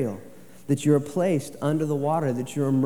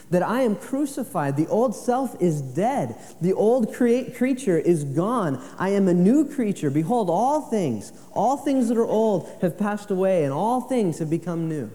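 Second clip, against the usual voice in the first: higher, louder proclaiming; forceful preaching; 7 semitones higher, 4 dB louder than usual. Acted. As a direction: proclaim the new creation to the church. Preaching new creature.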